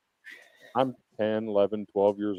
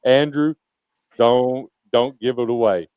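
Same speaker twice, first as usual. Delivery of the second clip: louder, halting protest; insistent; 2 semitones higher, 8 dB louder than usual. Real, not acted. [name] stopping Andrew spoiling his pick, insistent. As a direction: cut him off to protect the reveal. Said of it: "I'm serious".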